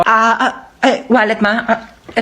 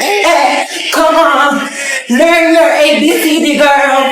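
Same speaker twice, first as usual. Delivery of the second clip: hyped shout; 5 semitones higher, slower than usual. Acted. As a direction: pump the toddler up like a coach. Hyping baby to learn.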